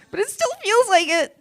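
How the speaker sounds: high, loud hammed-up whine; mock guilty wail. Comic after lawyers' assurance, high mock-guilty wail.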